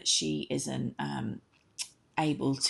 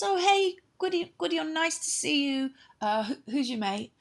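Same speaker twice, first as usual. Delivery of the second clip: high Arianrhod voice; 12 semitones higher than usual. Acted. Arianrhod greets Gwydion, voiced high.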